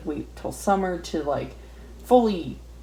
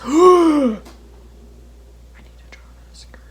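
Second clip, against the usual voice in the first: gasped whisper; 8 semitones higher, 11 dB louder than usual. Real, not acted. Sudden idea, gasp then whisper.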